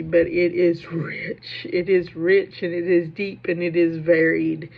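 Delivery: slow, each clause pressed home; a proud, deliberate build; emphatic insistence. Real, not acted. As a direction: insist on every word, proud of it. Insisting on Kentucky's literary richness.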